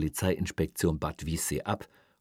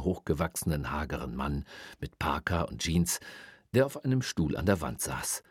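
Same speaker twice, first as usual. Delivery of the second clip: ordinary delivery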